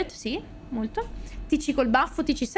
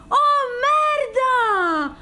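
higher, loud, stretched wail; high stretched cry of disbelief; shocked cry; 9 semitones higher, 5 dB louder, much slower than usual. Real, not acted. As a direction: push it higher the second time. Repeats the cry.

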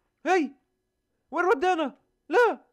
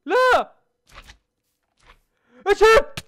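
high, loud startled cry; loud shocked reaction of a gamer; 10 semitones higher, 11 dB louder, slower than usual. Real, not acted. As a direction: yelp in shock at the monster. Shocked at strange monster.

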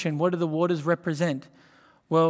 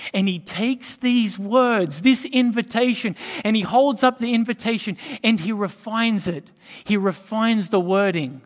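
higher and louder, slowed, building the story of the man; building preaching; 5 semitones higher, 5 dB louder than usual. Acted. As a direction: lift it up as if holding up the invitation.

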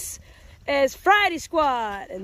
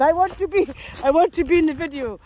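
a light, louder play-voice for the dog; 4 semitones higher, 7 dB louder than usual. Pretend. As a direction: speak for the eager dog, a bit louder. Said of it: "He said".

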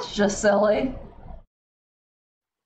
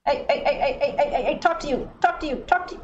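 frantic calling out; 5 semitones higher, faster than usual. Real, not acted.

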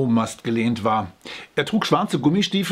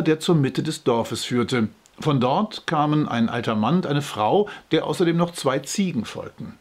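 plain reading voice

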